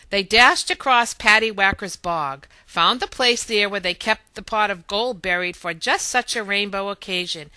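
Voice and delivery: ordinary delivery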